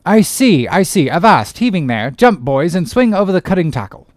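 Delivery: loud hail to his crew; called out across to the boats; captain cries out. Acted. Strange captain calls out to his crew, loud hail. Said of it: "cried out".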